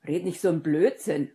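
loud, agitated rebuke; heated, forceful scolding; indignant shout. A narrator's reading. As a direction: call it out crossly. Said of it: "rief Ron aufgebracht".

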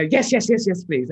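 high, loud eager yeses. Retold or imitated. Actress retelling accepting the role, eager loud yeses. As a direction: jump at the offer.

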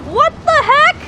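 shocked yelp. Real, not acted. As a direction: react in sudden alarm. Sudden shock at strange thing.

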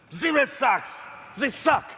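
hammed-up French accent, shouting the insult; big French accent. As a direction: ham up a French fighter's accent, spitting. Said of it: "big French accent".

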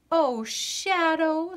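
slow, high and tender; girl welcoming her shadow back. A narrator's reading. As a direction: greet a lost friend gently.

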